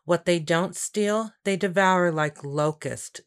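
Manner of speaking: usual speaking voice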